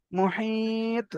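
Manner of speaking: মুহিত with a slow, long-held i; drawn-out madd. Shown as a demonstration. Teacher shows the ya madd stretch, long soft মুহিত. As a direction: hold the i in মুহিত on a level note, then echo the t. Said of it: "ইয়া মাদ্দা বলে টান হচ্ছে".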